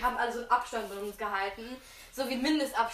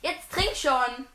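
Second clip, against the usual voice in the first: normal talking voice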